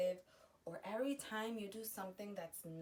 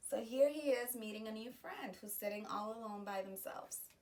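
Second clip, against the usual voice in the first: plain reading voice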